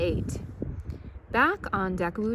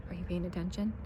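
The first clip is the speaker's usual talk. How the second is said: quiet aside; 8 dB quieter, much faster than usual. Real, not acted. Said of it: "aside to crew".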